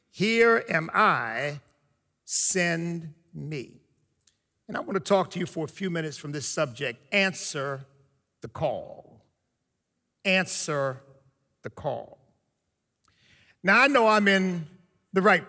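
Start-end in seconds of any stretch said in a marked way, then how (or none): 0.1-3.8 slow, low and hushed; quiet reverent recitation; solemn scripture reading. Acted. Repeated scripture line. 7.1-9.2 low, soft and drawn out; hushed title. Acted. Sermon title announced. 10.2-12.2 low, soft and drawn out; hushed title. Acted. Title repeated.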